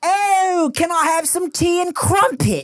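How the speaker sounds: high, hammed-up fake British accent; caricature British accent. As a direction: put on an exaggerated tea-time British accent. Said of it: "basic bitch American will be like".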